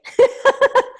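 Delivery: high burst of laughter. Real, not acted. Trainer laughing after joking she hates her video, amused laugh.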